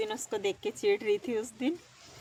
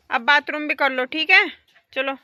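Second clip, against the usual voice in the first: calling out; 6 semitones higher, 8 dB louder than usual. Real, not acted. Urging the cow to pee.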